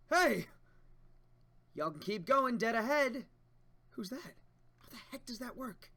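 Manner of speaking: high excited shout; loud character call. Acted. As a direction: call out across the lab. Reads manga dialogue voices.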